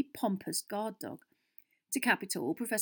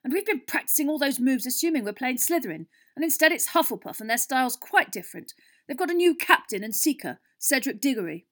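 loud, higher, agitated rant; bitter; 6 semitones higher, 8 dB louder than usual. A narrator's reading. Captain telling his team of the changed opponent, bitter. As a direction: complain bitterly at high volume. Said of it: "said Wood bitterly".

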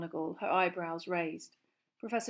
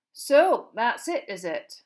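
beady; 4 semitones higher, 13 dB louder, much slower than usual. A narrator's reading.

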